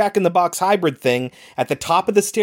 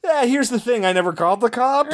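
higher-pitched imitation of an awkward admission; mock sheepish confession; 8 semitones higher than usual. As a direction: play him breaking bad news lightly. Voices doctor's awkward confession.

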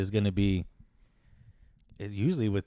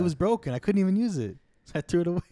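voicing dad; 7 semitones higher, 4 dB louder than usual. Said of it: "It's like".

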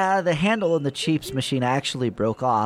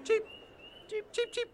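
very high, much softer squeaky chirps; cheep creature squeak; 12 dB quieter than usual. Retold or imitated. Pickle imitating the cheeps' chirping, tiny high squeak.

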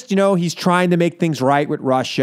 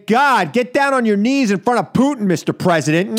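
normal talking voice

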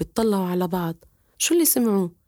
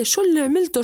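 higher, louder furious shout at his son; angry father yelling; 7 semitones higher, 4 dB louder, faster than usual. Acted. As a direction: start the father's scolding. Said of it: "صار يصيح عليه".

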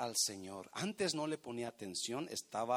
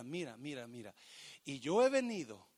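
normal talking voice

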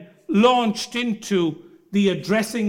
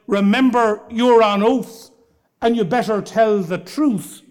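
louder, a barrister's stern warning to a witness; 6 dB louder than usual. Retold or imitated. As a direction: bark the warning so the witness fears perjury.

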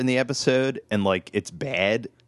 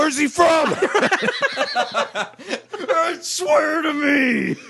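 mock shout; 3 dB louder, much slower than usual. Laughing mock outburst.

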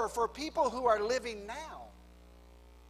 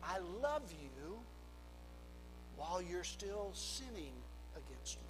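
tender God voice; 4 semitones lower, 12 dB quieter than usual. Acted. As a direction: hush it down and speak like God consoling a sinner. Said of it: "God said".

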